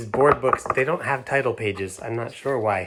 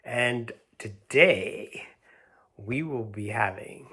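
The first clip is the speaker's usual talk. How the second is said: usual speaking voice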